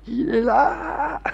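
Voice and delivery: whispered laugh, near tears. Real, not acted. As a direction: gasp the words through laughter. Host can't stop laughing.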